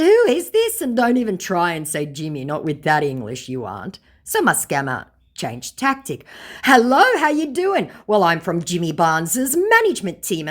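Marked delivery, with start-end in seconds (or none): 0.0-0.8 very high, loud "who is this"; shrill fake shock; mock incredulous. Challenging the scammer. 6.6-10.5 hammed-up cheerful scam greeting; mock scammer voice. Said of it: "laughing emoji; said my scammer".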